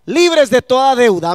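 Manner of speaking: loud, rising preacher's cry; preaching shout. Real, not acted. Preacher to his congregation on freedom from debt, a loud rising cry. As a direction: lift the whole church on this line.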